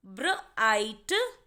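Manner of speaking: "Bright" drawn out very slowly, sound by sound; slow demo word. Shown as a demonstration. Teacher to students sounding out a word, very slow. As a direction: stretch "bright" out slowly for the class. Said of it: "ऐसे ही next word देखेंगे".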